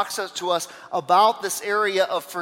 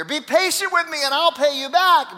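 high, urgent acted plea; begging; 7 semitones higher, 3 dB louder than usual. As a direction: beg for mercy as the desperate servant. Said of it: "fell on his knees and begged him".

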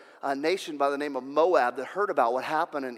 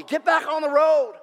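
angry shout; 11 semitones higher, 7 dB louder than usual. Acted. Prophet yelling at his disobedient donkey, angry shout. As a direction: yell it, high and loud.

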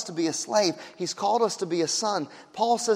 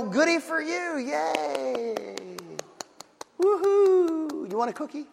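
mocking sarcasm; 5 semitones higher, much slower than usual. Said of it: "they're gonna go".

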